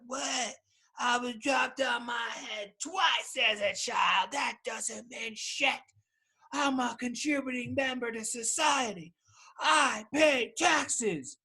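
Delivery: high, slow, wounded outrage; hammed-up offended yelling at himself; mock indignant shout. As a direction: protest way up high like you've been deeply insulted. Defending himself against his own mockery.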